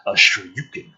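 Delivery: ordinary talk, nothing marked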